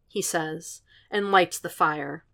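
usual speaking voice

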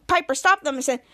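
ordinary delivery

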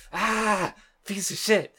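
high-pitched exasperated mock outburst; mock outrage. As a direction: cry out in hammed-up disgust. Acting out anger at the act.

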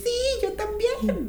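high, delighted chorus of agreement; mock high eager yes. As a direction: chirp it like every woman in the room. Imitating women agreeing.

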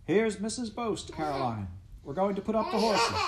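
high-pitched, hearty call. A narrator's reading. Pa announces the guest to his wife, calling out. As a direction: call through the door to those inside. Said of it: "and Pa called".

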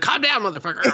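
high-pitched mock yell to snap him out of it. The dog yelling at the raging ogre boy, high-pitched mock yell.

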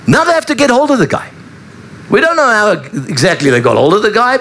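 usual speaking voice

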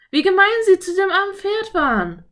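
put-on high, loud girlish outrage; indignant anger. A narrator's reading. Girl to her brother about the mistreated horse, furious. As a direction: protest hotly, pitched right up. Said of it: "sagte Anne wütend".

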